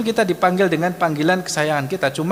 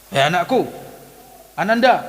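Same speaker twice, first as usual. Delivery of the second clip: mock scolding with tender words; raised, snapped endearment; wrong, harsh intonation; 3 semitones higher than usual. Shown as a demonstration. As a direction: call the child too sharply. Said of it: "Jadi nggak pas intonasinya gitu".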